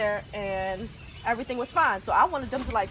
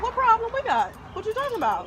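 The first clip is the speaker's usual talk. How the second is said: higher, disbelieving what-are-you-saying; indignant; 10 semitones higher, faster than usual. Retold or imitated. Retelling the seller's email, outraged and disbelieving. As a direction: throw back the question in outrage. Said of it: "And I'm like".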